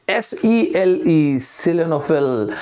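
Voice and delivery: each letter spaced out, slowly; slow teacher spelling; spelled out slowly. Shown as a demonstration. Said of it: "spelling it out".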